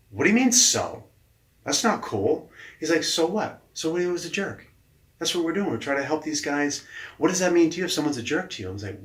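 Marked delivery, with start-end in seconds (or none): 0.1-2.5 higher, louder protest; indignant comeback. Retold or imitated. Upset coach challenging his boss's shrug, indignant. 3.0-8.7 high-pitched, insistent questioning; challenging boss. Retold or imitated. Boss confronting his upset coach about the conflict, challenging.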